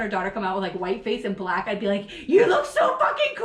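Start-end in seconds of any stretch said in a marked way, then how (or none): 2.3-3.5 very high, loud, laughing delight; overjoyed mock cheer; hammed-up thrilled mom; gushing parent. Mock-excited parent imagined.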